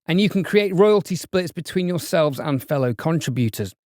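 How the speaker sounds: upbeat podcast ad voice; sponsor ad read. Acted. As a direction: read the sponsor spot brightly to listeners.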